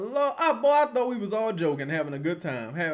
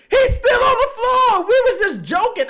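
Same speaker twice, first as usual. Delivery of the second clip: laughing shout; 14 semitones higher, 10 dB louder than usual. Real, not acted. Shocked the player stays in.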